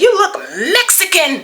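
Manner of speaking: high mocking voice with a laugh; mocking the man, laughing. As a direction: sneer it up high through a laugh. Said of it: "He said".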